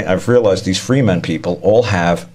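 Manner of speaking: ordinary talk, nothing marked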